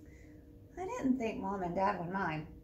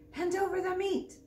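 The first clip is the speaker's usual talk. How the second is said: pirate voice; 9 semitones higher than usual. A narrator's reading. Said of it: "Braidbeard gulped his food and said".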